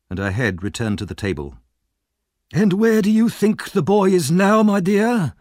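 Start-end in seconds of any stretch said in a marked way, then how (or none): 2.5-5.3 high, loud oily voice. Acted. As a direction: coax her slyly.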